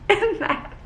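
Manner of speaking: breathy laugh into the words; cracking up at the book. Real, not acted. Reader laughing at Hunt's two options.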